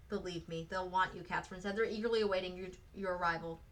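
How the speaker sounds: ordinary delivery, nothing marked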